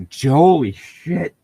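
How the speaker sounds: normal talking voice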